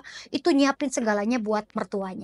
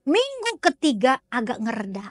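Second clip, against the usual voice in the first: ordinary talk, nothing marked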